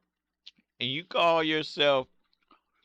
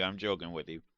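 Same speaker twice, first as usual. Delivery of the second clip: softer and lower, reassuring; quiet; 7 semitones lower, 8 dB quieter, faster than usual. Real, not acted. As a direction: say it gently, backing off.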